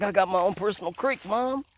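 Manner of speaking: high, put-on voice for the playful dog; voicing the dog. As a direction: speak for the dog as if she's gloating about her creek. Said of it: "She's like".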